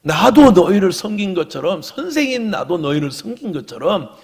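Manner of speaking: loud, high-pitched exhortation in swelling waves; full-force preaching. Real, not acted. Pastor exhorts the congregation to follow Jesus, impassioned loud preaching. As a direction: preach it out loud, stretching the key phrases.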